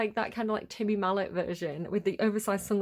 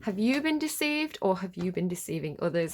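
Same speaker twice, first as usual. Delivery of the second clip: ordinary talk, nothing marked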